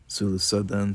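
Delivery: plain reading voice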